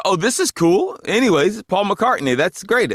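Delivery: retold excitement. Retold excited reaction to [name].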